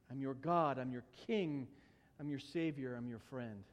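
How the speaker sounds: low voice, softening towards the end; tender reassurance. Acted. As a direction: quietly assure them, phrase by phrase. Voicing God reassuring the listeners.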